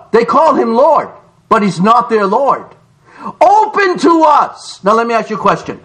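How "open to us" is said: pleading cry. Acted. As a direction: plead at a shut door. Foolish virgins plead.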